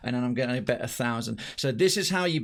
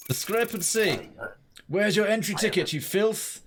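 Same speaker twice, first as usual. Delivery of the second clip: put-on Russian accent; 7 semitones higher than usual. Inspector scorns a traveller without ticket in Russian accent. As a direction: spit it at the entrant in Russian accent. Voicing inspector scolding entrant.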